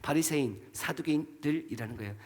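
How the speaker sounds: usual speaking voice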